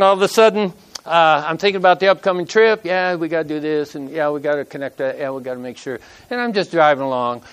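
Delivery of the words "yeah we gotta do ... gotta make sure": fast, softer and softer, fading to near nothing; muttered inner thoughts. Retold or imitated. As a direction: mumble your plans to yourself, trailing off. Inner thoughts while driving.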